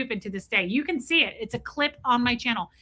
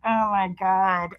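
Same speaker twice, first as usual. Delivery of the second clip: slow, low mortified oh my God; dawning embarrassment; mildly embarrassed realization; lame realization; 4 semitones lower, much slower than usual. Retold or imitated. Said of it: "so lame the way I said it".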